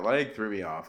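ordinary talk, nothing marked

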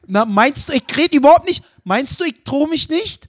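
high, indignant Berlin-dialect challenge; mock-outraged Berliner; Berlin dialect threat. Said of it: "jetzt redest du wie ein Deutscher".

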